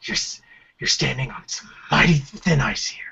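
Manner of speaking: low, warning tough-guy voice; Joel. Acted. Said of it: "maybe Joel from Last of Us?".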